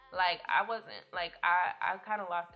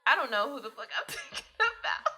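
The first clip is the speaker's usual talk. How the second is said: higher, breaking into a laugh mid-line; 5 semitones higher, 5 dB louder than usual. Real, not acted. Admitting to the audience she's lost, laughing. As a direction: give up on the name, laughing. Laughing at her own confusion.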